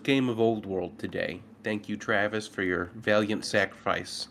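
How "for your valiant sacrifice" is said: ordinary talk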